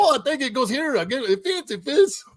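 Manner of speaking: high, silly mock worker voice; mock dim assembler. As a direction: squeak out a clueless worker. Mocking assembler fitting wrong parts.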